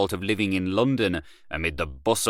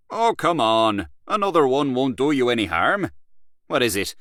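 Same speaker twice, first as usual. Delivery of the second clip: louder, higher, pushing a friend to drink; hearty coaxing Gallaher; 6 semitones higher, 4 dB louder than usual. Acted. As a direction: come on, one more won't hurt. Gallaher pressing another drink.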